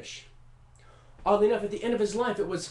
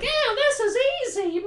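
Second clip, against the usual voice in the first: high, breezy, carefree show-off voice; tossing it off like it's nothing; very high smug genius squeak; Mozart mockery; 6 dB louder than usual. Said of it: "He's like".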